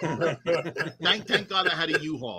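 laughing through the words; real laughter. Real, not acted. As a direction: crack up while answering. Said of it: "Did you have a big car".

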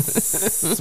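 "This" with the final S drawn out as a hiss; playful long sibilant on "This"; snake-like S. Pretend. Hissing the S's, snake joke.

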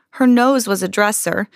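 ordinary delivery, nothing marked

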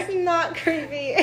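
laughing while teasing. Real, not acted. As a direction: tease it through a laugh. Teasing laugh at her habit.

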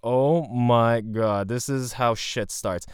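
very slow, drawn-out alarm; dread, slowed down. Retold or imitated. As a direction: let the dread stretch each word. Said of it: "And I was like".

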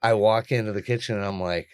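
normal talking voice

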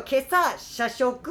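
very slow, each syllable drawn out; saying it slowly. Shown as a demonstration. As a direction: spell the tongue twister out slowly. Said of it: "ゆっくり言うと、".

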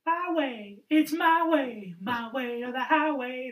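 sing-song mock of a wrestler who does as he likes; Cody Rhodes impression. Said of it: "That's his direction".

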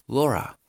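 Laura said "lora"; open O. Shown as a demonstration. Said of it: "como si fuera una O abierta". (Laura con O abierta, "lora")

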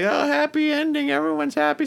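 high mocking falsetto of fake cheer; sing-song parody of a happy-ending script; sneering high fake-happy voice; mock cheerful. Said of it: "fake ass stuff that's like".

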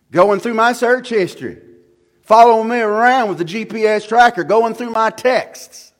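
loud, high-pitched sermon delivery; full-force preaching. Real, not acted. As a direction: preach it out loud, leaning hard on key words.

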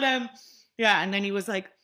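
ordinary delivery

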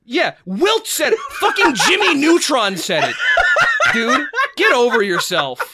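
mock outrage that keeps cracking into laughs; laughing while ranting at a cameo actor. Real, not acted.

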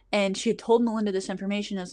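ordinary delivery

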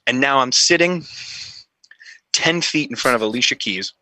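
ordinary talk, nothing marked

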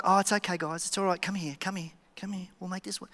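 mock-gentle, fading almost to nothing; soft reassurance. Imagined lenient Jesus comforting his disciples, softly soothing. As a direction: coddle them softly, as if soothing. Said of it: "he wasn't just like".

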